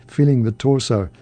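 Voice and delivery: usual speaking voice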